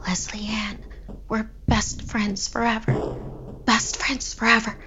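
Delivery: hollow, dazed whisper. A narrator's reading. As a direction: whisper it blankly over and over. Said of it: "she whispered over and over".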